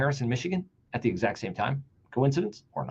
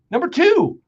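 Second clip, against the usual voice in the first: reading Cuban; 13 semitones higher, 6 dB louder than usual. Retold or imitated. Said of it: "this is Cuban's statement".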